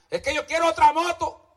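high mocking voice of a greedy churchgoer; mock whiny believer. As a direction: whine it like a spoiled believer.

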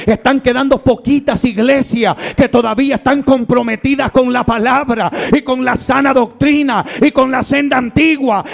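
rising, driving sermon voice, building to a shout; fiery preaching. Acted. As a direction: preach it hard and fast to the congregation. Predicador en pleno sermón. (predicación ardiente que va creciendo hasta gritar)

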